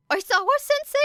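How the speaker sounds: put-on anxious boy. Boy protests, high-pitched.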